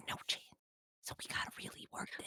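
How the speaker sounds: hushed conspiratorial whisper; a quiet aside to Bonnie. Acted. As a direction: lean in and whisper it like a secret. Confiding aside about the client.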